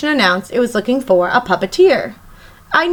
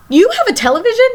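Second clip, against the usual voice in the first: put-on surprised child; 10 semitones higher, faster than usual. Friend's TV surprises the boy.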